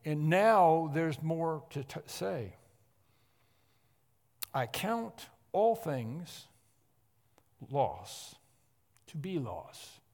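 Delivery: slow, weighty scripture reading; emphatic reading. Shown as a demonstration. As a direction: lay each word down deliberately. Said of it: "and emphatically".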